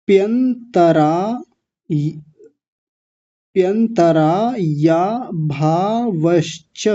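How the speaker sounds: प्यंतराया भावश्च said very slowly, piece by piece; slow breakdown. Shown as a demonstration. Teacher breaks the word down for learners, slowly. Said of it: "थोड़ा और तोड़ते हैं".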